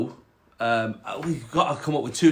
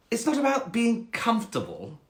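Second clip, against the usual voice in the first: high, haughty cat; snobby cat; 8 semitones higher than usual. Acted. Cat rebuking the frog, snobby and high. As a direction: put the frog down snootily. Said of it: "quite a snobby cat".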